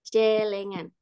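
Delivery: "celengan" with an open e as in "bed"; E-sound demo. Shown as a demonstration. Teacher showing the E of I E N, open "celengan". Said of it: "Dan E ini bunyi".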